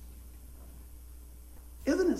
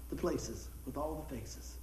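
slow preaching cadence; 8 semitones lower, 9 dB quieter, slower than usual. Real, not acted. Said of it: "preaching line slowed".

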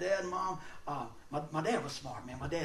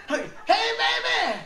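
very high, loud catcall; mock catcall, pitched way up; yelling at girls; 6 dB louder, slower than usual. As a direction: work up to a big holler. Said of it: "let's go yell at the girls".

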